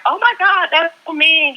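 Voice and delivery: high-pitched scandalized reaction; mock-shocked. Imitating people who might think it mean, mock-shocked. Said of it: "people might think like".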